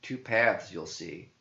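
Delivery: ordinary delivery, nothing marked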